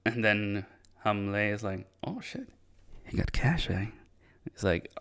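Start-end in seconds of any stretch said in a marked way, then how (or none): 2.0-3.9 hushed, much higher retold realization; furtive aside. Storyteller voicing Hamle spotting the cash, hushed and furtive. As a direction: voice a sly aside under the breath. Character secretly notices the cash.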